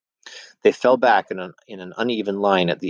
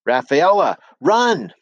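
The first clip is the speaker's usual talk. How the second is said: much higher, louder desperate yell; urgent shout; 9 semitones higher, 4 dB louder, slower than usual. A narrator's reading. Narrator yelling to Rafaela to flee the mob. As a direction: scream a warning, rising into panic on run.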